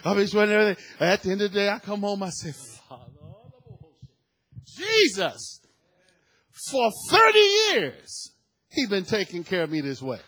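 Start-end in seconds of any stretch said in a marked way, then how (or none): 2.9-10.2 shouted thanksgiving prayer; fervent praise. Retold or imitated. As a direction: pour out thanks to Jesus.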